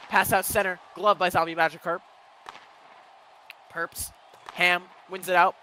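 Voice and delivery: fast live hockey commentary; play-by-play. Real, not acted. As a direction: call the action as it happens.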